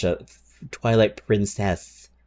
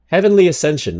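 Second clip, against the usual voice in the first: high and loud burst; excited exclamation; 7 semitones higher, 5 dB louder than usual. Real, not acted. Streamer excitedly naming the new card. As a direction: hype it up.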